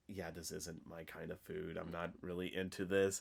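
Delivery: low, soft imitated disinterest; unimpressed. Imitating dinner guest turning down fancy food, unimpressed. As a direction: decline it quietly, pitch dropped.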